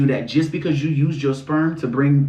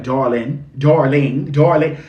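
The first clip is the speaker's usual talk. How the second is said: brash New York "darling", growing louder; punchy New York accent impression; New Yorkers; 4 dB louder, slower than usual. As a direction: imitate a New Yorker, building with each repeat. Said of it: "when the New Yorks be like".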